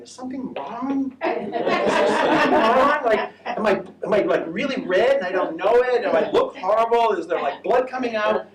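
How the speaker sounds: playing her own baffled panic, half laughing; mock alarm. Recalling how strangers' greetings alarmed her, mock panicked and laughing. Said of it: "I was going".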